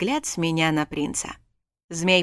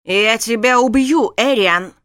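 slow, much louder enraged roar; enraged husband's death threat; furious shout; 4 semitones higher, 9 dB louder than usual. Acted. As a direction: scream it at him. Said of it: "Таким взбешённым змея".

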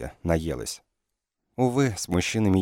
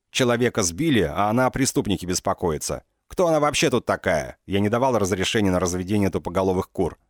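angry tirade; 2 semitones higher than usual. Acted. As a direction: rage at her in front of everyone. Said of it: "прогремел сбитый".

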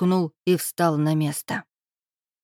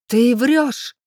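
higher, louder frantic scream; frenzied yell; 6 semitones higher, 6 dB louder than usual. A narrator's reading. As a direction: scream the accusation. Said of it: "иступлённо заорала Ольга".

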